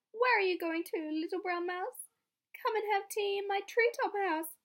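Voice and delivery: sweet-talking owl voice; hooting owl. Acted. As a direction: lure the mouse up the tree. Owl invites mouse.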